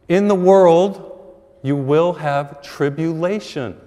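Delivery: slow, loud, weighty quote from the gospel; preaching the scripture. Acted. Preacher quoting Jesus to the congregation, slow and loud. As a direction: lean on every word like scripture. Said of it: "What did Jesus just say in the gospel?".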